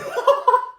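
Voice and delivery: a high, bright burst of laughter. Real, not acted. Joking about Greek life, a high laugh.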